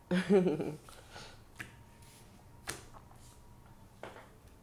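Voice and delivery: real laugh, quickly tailing off; knowing laugh. Real, not acted. Reader laughs after "clock it.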